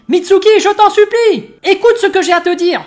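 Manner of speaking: put-on pleading girl voice. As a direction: beg him to listen, voiced high. Sarada voiced begging Mitsuki.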